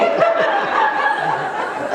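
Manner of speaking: giggling hard at himself; squeaky helpless laugh; high-pitched giggle. Real, not acted. Laughter peak.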